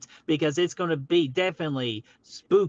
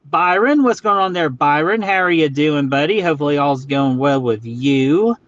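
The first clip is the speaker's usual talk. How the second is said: loud greeting; 8 dB louder than usual. Real, not acted. Loudly greeting a chat viewer.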